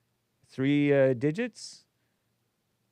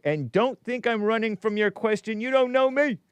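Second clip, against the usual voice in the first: high, raised-voice reading; speaking loud; 10 semitones higher, 4 dB louder than usual. Acted. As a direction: build to a louder, higher protest. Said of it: "Speaking loud".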